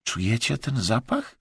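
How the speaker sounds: soft, suspenseful question; hushed, softly rising query; whispering. A narrator's reading. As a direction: ask it in a tense hushed voice. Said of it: "ten wyszeptał tylko".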